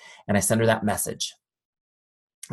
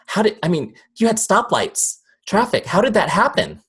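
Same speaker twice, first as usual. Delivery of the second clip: high, loud disbelief; incredulous amazement; 10 semitones higher, 5 dB louder than usual. Shown as a demonstration. Speaker amazed at drivers arriving without knowing how, high incredulous voice. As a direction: marvel loudly, pitch way up. Mock amazement at driving unawares.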